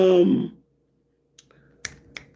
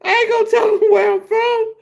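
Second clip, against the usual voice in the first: much higher, half-laughing insistence. Real, not acted. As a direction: squeak it out through the laughter. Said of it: "I'm serious, man".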